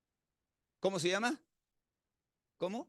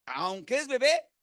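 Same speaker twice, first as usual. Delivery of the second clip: mock baby pleading; 7 semitones higher, 6 dB louder than usual.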